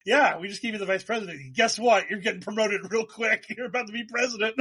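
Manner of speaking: high-pitched teasing rush; gleeful, fast and high; high, fast, laughing. Retold or imitated. Aide teasing Ford.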